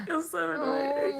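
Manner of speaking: exasperated. Acted. Fond exasperation.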